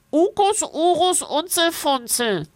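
hoch und näselnd; durch die Nase. A narrator's reading. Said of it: "Es spricht durch die Nase". (hoch und näselnd gesprochen)

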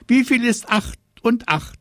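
put-on high, loud little-girl quizzer; high-pitched child's challenge; quizzing sister. A narrator's reading. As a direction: pipe the sum up high like a pestering sister. Sister quizzing brother in story.

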